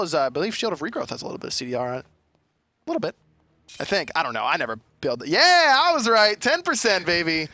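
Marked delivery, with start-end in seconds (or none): 5.8-7.5 higher, gloating cheer; triumphant. Real, not acted. Caster boasting to co-caster after a lucky guess, triumphant. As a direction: crow about being right. Celebrating being proved right about item.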